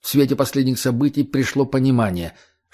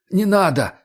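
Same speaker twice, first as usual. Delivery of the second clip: high, quick panicked no; frightened refusal; 7 semitones higher than usual. A narrator's reading. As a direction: wave it off in fright.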